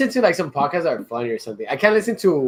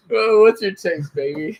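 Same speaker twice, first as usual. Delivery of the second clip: usual speaking voice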